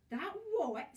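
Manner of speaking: sudden high yelp of alarm; high startled shriek; shrill scream. A narrator's reading. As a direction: shriek it out in fright. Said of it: "screamed Missus Twit".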